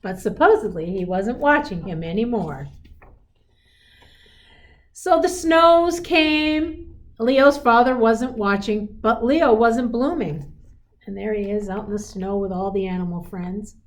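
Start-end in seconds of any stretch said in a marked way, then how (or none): none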